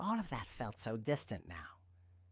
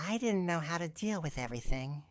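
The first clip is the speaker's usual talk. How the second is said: fast, words tumbling out; rushed; 6 semitones higher, 5 dB louder, faster than usual. Acted. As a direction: pour it out in a rush. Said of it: "her words pouring out in a rush".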